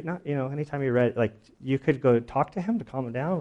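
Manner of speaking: ordinary talk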